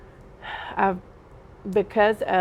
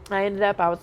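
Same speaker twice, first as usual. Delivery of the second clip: usual speaking voice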